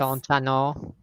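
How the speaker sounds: rounded Minnesota vowels, played up a little; Minnesota accent. Shown as a demonstration. Repeating it for the amused listeners, Minnesota accent. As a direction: put on an Upper Midwest accent. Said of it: "wants to hear your accent".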